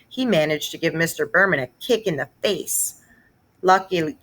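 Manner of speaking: ordinary delivery, nothing marked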